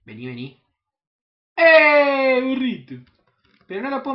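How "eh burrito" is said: calling shout. Real, not acted. Calling out to donkey.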